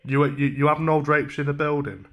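usual speaking voice

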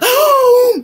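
sharp, high gasp; loud excited intake of breath; surprised gasp. Real, not acted. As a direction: gasp in delight. Sudden arrival in the chat.

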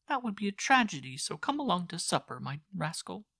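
high girlish voice, mock-tragic teasing; teasing sister. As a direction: tease your brother into coming to supper. Girl coaxes her brother.

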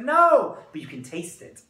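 imitated Israeli guide correcting; emphatic Israeli-accented correction. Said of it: "Here's my Israeli accent".

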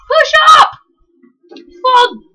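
high, loud urging of teammates; urgent cheering. Real, not acted. Urging teammates up the pitch, high and loud. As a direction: push your team forward.

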